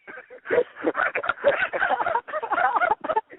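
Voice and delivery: loud, high-pitched laughing fit; real laughter. Real, not acted. Comic cracking up after the punchline, loud laughing. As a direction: burst out in long, high laughter.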